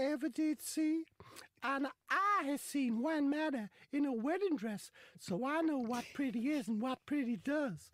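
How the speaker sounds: high, loud, slow Southern drawl; Southern gentleman drawl. Retold or imitated. Footballer chatting up the lass in a high Southern drawl.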